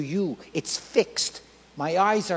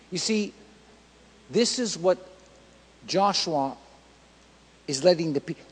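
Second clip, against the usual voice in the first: normal talking voice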